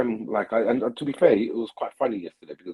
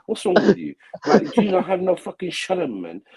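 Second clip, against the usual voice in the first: laughing while mocking; 8 semitones higher than usual. Real, not acted. Mocking a dirty guy, laughing.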